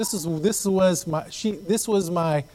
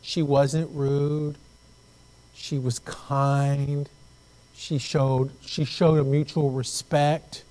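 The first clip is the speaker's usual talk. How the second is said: very slow, drawn-out sincere delivery; slow, earnest praise; much slower than usual. Real, not acted. Pastor praising his wife's kindness, slow and earnest. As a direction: linger tenderly on each phrase.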